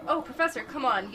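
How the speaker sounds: ordinary talk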